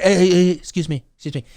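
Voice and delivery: a mild imitation of Trump cutting in and calling someone a liar; Trump impression. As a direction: talk over the moderator like a brash politician. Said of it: "Trump is like".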